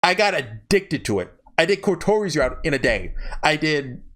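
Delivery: normal talking voice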